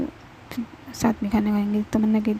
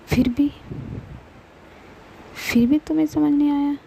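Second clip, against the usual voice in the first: angry scolding; 2 semitones higher than usual. Acted. Mahi angrily scolding her cousin over the body wash. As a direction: scold her, building in heat. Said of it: "ये किस तरीके से बात कर रही हो तुम".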